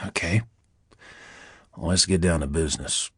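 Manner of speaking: low, honeyed Deep South purr; languid Southern drawl. A narrator's reading. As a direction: purr it like a Southern plantation owner. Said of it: "languid southern drawl of a plantation owner".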